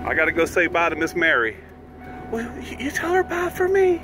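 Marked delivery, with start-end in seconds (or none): 2.3-4.0 high voiced dog complaining; dog's voice. Owner voicing Martini the dog answering, high and squeaky.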